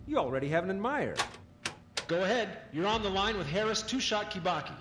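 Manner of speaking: cheerful broadcaster introducing a guest; radio host. Acted. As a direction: sell it like a live broadcast host. Radio host takes a caller.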